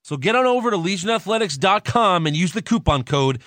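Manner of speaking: loud, upbeat sponsor ad read. Acted. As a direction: sell it like a radio ad. Reads a sponsor ad.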